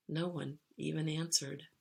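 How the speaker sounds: ordinary delivery, nothing marked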